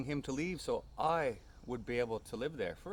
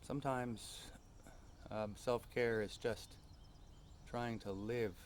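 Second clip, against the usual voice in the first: gentle meditation; 6 dB quieter, slower than usual. Acted. Reflective slow self-care passage.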